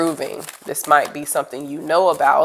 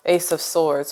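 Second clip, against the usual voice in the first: normal talking voice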